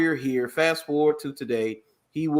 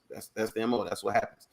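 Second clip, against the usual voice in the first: ordinary talk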